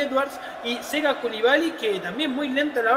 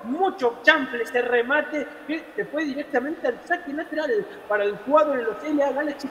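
usual speaking voice

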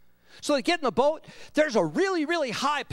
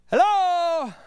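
loud, high drawn-out holler; calling out; 5 semitones higher, 5 dB louder than usual. Acted. Jesus calls from shore to rower leaving him behind, calling out. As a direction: call across water, long and high.

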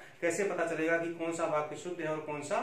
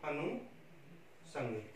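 very slow and soft, in two parts; quiet dictation with a long gap mid-word; correct spelling dictated; 3 semitones lower, 10 dB quieter, much slower than usual. Shown as a demonstration. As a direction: say it part by part, as if writing it. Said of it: "ये हो जाएगा".

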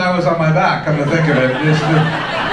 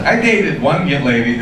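normal talking voice

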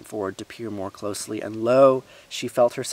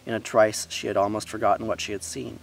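plain reading voice

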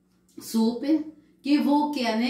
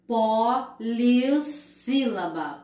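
polissílaba said slowly, syllable by syllable; slow drawn-out; much slower than usual. Shown as a demonstration. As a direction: stretch polissílaba for the class. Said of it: "Quatro ou mais sílabas".

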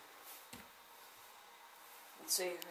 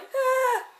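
higher, louder mock frightened "ah"; imitating a scared sound; 13 semitones higher, 11 dB louder than usual. Teasing a sibling about their scared noise, mock frightened "ah". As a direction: tease a sibling by copying their squeak. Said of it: "You made, like, sounds like".

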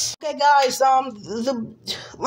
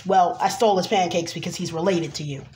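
usual speaking voice

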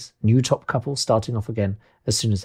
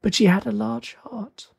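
very high, slow ghost voice; 10 semitones higher than usual. Acted. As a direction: draw it out high, as a spirit. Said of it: "said the ghost".

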